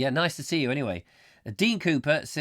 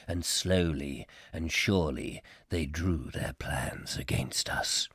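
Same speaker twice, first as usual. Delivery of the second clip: low, slow, portentous storyteller; gravelly narrator; 6 semitones lower than usual. Acted. Host recites the War of the Worlds opening, gravelly and ominous. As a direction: intone it gravely, sinking to a near whisper. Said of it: "Got the right kind of gravelly voice now to do that".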